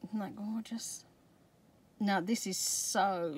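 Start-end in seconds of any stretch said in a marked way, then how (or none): 0.1-1.0 hushed admiring whisper; whispering to the camera over a pretty find. Real, not acted. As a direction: breathe it out in awe. Admiring paper up close.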